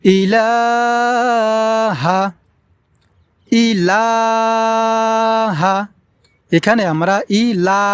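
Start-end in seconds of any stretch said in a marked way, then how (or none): 0.0-5.7 ইলাহা with the লা held very long; slow chanted recitation; elongated ইলাহা. Shown as a demonstration. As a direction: stretch লা in ইলাহা. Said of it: "এখানে আমরা টেনে পড়ব এইভাবে".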